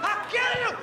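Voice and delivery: high, strained fighter's yell; mock threat. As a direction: imitate a man threatening mid-struggle. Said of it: "He's like".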